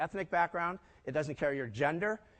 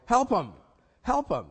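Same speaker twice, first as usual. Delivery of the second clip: emphatic rally; 5 semitones higher, 6 dB louder, slower than usual. Retold or imitated. Rallying colleagues.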